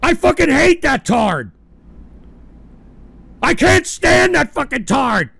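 loud, high spiteful yelling; angry rant. Real, not acted. Streamer venting hatred at Barry Blackberry. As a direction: spit it out with contempt.

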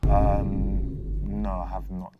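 low, halting "no"; hesitate, then deny it unconvincingly; low-voiced, drawn-out hesitant answer; hesitant denial. Real, not acted. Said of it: "You sound like you're lying. The hesitation".

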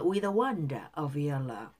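"with the wonder" run together smoothly; linked words. Shown as a demonstration. Teacher shows how to connect "with the", linked words. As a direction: link "with" straight into "the" in "with the wonder". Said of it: "더를 연결해서".

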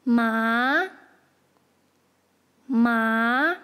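麻 in a rising second tone; drawn-out 麻 climbing like a question; tone demonstration. Shown as a demonstration. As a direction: start 麻 low and sweep it upward. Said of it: "Tell us the tone of each".

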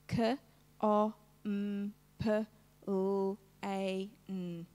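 "K, O, M, P, L, A, N" as separate phonemes, kuh, o, mmm, puh, lll, ay, nnn; sounded out. Shown as a demonstration. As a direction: segment "K, O, M, P, L, A, N" sound by sound, not letter names. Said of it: "Show me the sounds".

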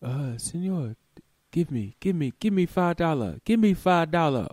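put-on high, pushy Colombian accented demand; lightly mocked Colombian beggar. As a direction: beg loudly in broken English. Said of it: "they just ask you".

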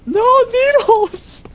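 slow, high, loud cry; high shout. Real, not acted. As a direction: stretch out a loud panicked cry. Enemy has needler.

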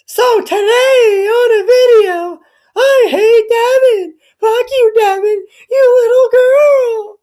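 put-on high, slow, crying squeal of a whiny kid; mocking whiny kid voice. Mocking the YouTuber kid as a high crying whiner. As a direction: wail it like a bratty child. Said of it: "Here's, here's how you are".

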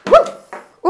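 high, loud whoop of delight; excited whoop. Real, not acted. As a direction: let out a thrilled high woo. Reacting to the pulled cards.

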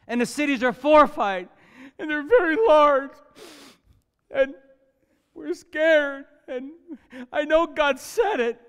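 mock whiny, scared spies; high, whiny mock-scared report; whining spies. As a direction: ham up the complaining scouts.

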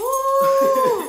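shrieking laugh through the burn; loud high laugh. Real, not acted. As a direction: burst into a high cackle. Reaction during spicy challenge.

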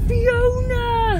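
high, drawn-out call of Fiona; Eurotrip Fiona call. Retold or imitated. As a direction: yell Fiona like the Eurotrip scene. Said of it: "It's from Eurotrip".

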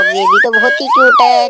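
excited high squeal at something cute. Acted. Gushing over the cute baby toy.

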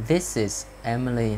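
slow, careful "This is Emily"; teacher's slow model of "This is Emily"; slow reading. Shown as a demonstration. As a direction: take "This is Emily" word by word. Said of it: "khi đọc các câu này mình đọc chậm".

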